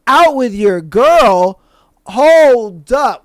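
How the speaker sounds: slow, loud, incredulous echo; acted-out disbelief. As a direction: stretch it out, loudly taken aback in the retelling. Retold shock at the accusation.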